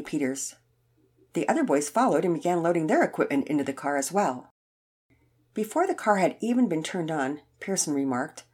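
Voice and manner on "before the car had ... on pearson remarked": ordinary delivery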